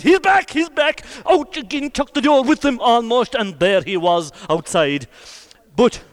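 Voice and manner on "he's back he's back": excited announcement. Acted. Man announces rider's return.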